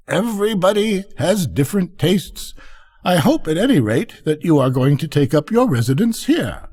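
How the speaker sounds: smooth things over kindly as the duck; lightly voiced easygoing duck; mild peaceable duck.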